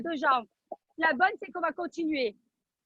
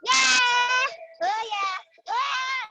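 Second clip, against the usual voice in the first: excited cheering; 6 semitones higher, 3 dB louder, much slower than usual. Real, not acted. Coach cheering with music.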